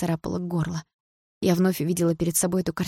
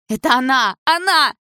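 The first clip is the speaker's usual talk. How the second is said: jealous shout; 9 semitones higher, 7 dB louder than usual. A narrator's reading. Jealous fight climax.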